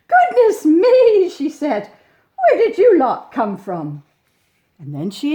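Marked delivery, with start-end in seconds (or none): 0.1-1.3 much higher and louder, slow astonished cry; hammed-up surprise; shocked gasp. Mum nearly fainted. 2.4-4.0 louder, amazed question; flustered mum at the door; mock shock; astonished mum. Mum surprised by bears.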